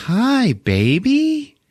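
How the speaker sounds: very high, drawn-out sugary greeting; cooing mother. Retold or imitated. Crazed mother greeting her doll, high slow coo. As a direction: coo slowly as if to a baby.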